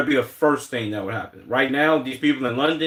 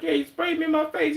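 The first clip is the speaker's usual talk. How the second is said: mock whiny retelling. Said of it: "They're like".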